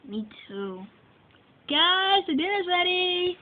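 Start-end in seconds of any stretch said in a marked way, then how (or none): 1.7-3.4 calling across the house. Acted. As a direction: call them to dinner. Mom calling kids to dinner.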